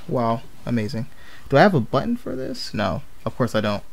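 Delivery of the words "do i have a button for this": normal talking voice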